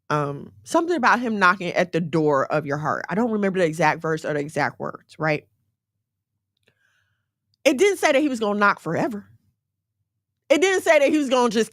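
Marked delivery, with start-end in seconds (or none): none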